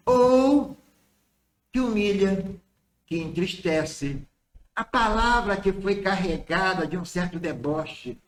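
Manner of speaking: ordinary talk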